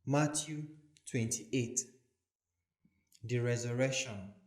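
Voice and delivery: ordinary delivery, nothing marked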